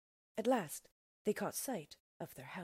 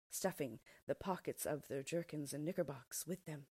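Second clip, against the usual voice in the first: ordinary delivery, nothing marked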